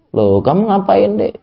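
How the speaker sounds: sarcastic parent. Retold or imitated. Parent mocking a child who did wrong, sarcastic high tone. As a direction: needle the child with a fake-surprised question. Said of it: "orang tuanya nyindir".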